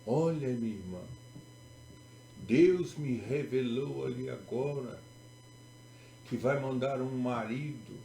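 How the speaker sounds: pious church sister. Acted. Church sister revealing a husband to someone praying, mock-pious. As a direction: stretch the key words like a self-appointed prophetess. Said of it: "sabe aquelas irmãzinhas assim, contristadas, simples, super crentes".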